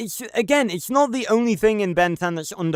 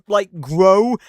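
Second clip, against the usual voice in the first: ordinary talk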